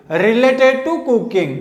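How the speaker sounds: drawn-out, deliberate; very slow. Shown as a demonstration. Teacher repeating the English meaning very slowly. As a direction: stretch every word of related to cooking. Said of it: "repeat slowly".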